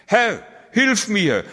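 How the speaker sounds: high, loud, drawn-out cry for help; desperate cry. Acted. Sinking Peter cries to Jesus for help, a high, loud, slow cry. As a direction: cry out as if sinking beneath the waves. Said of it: "schrie er".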